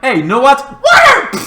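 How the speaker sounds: excited shout. Retold or imitated. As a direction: yell the idea out. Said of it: "So Cal is like".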